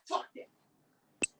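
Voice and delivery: soft, near-whispered cutoff; cutting off the crowd under the breath; sudden hush. Real, not acted. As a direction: drop it to a hush. Host abruptly cuts the chant.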